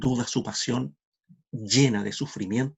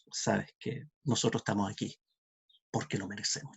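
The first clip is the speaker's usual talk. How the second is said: repentant thief, hushed; 3 semitones lower, 8 dB quieter than usual. A narrator's reading. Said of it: "Y el otro va y le dice".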